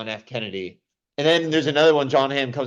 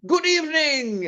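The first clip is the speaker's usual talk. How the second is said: far higher, slow, hammy horror-host greeting; Bill Hader's Vincent Price. Retold or imitated. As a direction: overdo the creepy host. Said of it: "him trying to be all".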